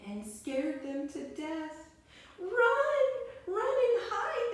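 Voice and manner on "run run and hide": much higher, louder panicked run; panicked shout to run; despairing cry. Acted. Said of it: "they all cried in despair".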